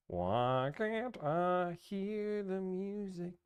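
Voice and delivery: high, slow, whining; drawn-out whine; whiny high pitch. Real, not acted. Struggling with audio, whining.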